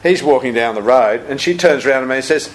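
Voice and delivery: ordinary delivery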